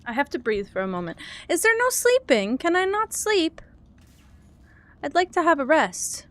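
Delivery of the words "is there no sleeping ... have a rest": higher, begging whine; whiny plea. Real, not acted. Pleading to sleep.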